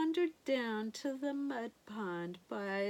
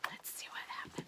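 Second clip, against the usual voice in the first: whispering; 12 dB quieter, much faster than usual. Real, not acted. Storyteller builds suspense aside.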